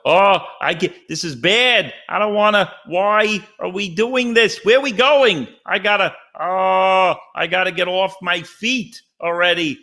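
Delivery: high whiny kvetching; Ray Romano whine. Retold or imitated. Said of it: "started kvetching like Ray Romano".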